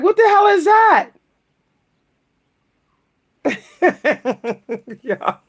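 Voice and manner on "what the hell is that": high-pitched incredulous question; shocked reaction. Acted. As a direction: act out viewers' baffled shock. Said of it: "Y'all gonna be like".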